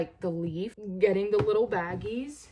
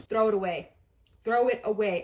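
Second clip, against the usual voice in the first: firm command; 6 dB louder, faster than usual. Real, not acted. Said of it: "I cannot emphasize this enough".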